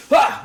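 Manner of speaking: loud, high cry of surprise; sudden high "ah"; startled yelp. Acted. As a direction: yelp as if knocked off balance. Game master acts disoriented character.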